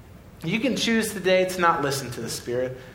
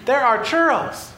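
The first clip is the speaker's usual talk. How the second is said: put-on can you believe this kid, bursting with it; mock-outraged preacher listing Disneyland joys to a boy; incredulous; 4 semitones higher than usual. Incredulous rant at the little boy.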